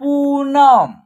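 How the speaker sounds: पूनम with पू drawn out; long vowel. Shown as a demonstration. Teacher joining the letters together, पूनम with long ऊ. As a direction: hold the ऊ in पूनम. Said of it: "उच्चारण long तरीके से करेंगे".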